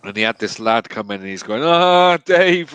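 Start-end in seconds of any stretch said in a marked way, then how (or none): 1.6-2.7 higher, booming jeer at a workmate; mock-cocky shout; loud taunting call. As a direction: holler it out to wind him up. Acted taunt at workmate Dave.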